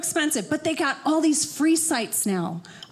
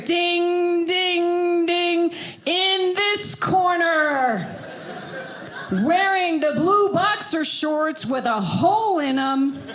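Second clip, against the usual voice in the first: drawn-out, sing-song boxing announcer call; announcing husband versus wife in the ring. Pretend. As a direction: boom it like a prize-fight intro.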